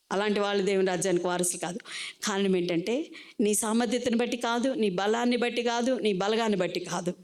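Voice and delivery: ordinary delivery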